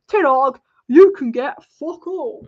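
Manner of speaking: loud, slow put-on voice of a stingy club; hammed-up loud refusal from the club; mock club brush-off. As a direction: bellow it slowly like the board refusing him. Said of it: "They probably said".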